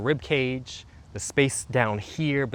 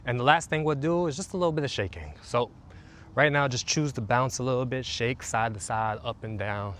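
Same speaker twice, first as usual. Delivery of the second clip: guided meditation. Acted. Coach leading listeners through a calming routine, soothing meditation voice. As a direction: guide them gently into the body.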